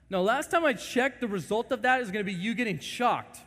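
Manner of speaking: loud, high scolding; stern parent. Retold or imitated. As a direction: lay down the law to a toddler.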